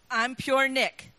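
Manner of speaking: slow broad Scots, rolling pure; Scottish accent. Shown as a demonstration. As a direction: say it slowly like a Glasgow friend.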